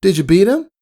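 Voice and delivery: high filly voice; eager Scootaloo. A narrator's reading.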